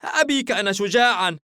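put-on tearful, defiant boy, high and loud; Haim. A narrator's reading. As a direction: protest passionately like a child close to tears. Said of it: "حاييم بعينين دامعتين".

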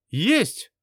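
high, loud eager yes-sir; high eager exclamation. Acted. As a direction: snap it back like a keen soldier. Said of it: "воскликнул он".

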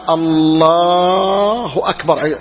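long-held الله before أكبر; drawn-out takbir. Shown as a demonstration. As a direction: stretch الله steadily while rising to stand. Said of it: "وهو في الطريق للقيام قال".

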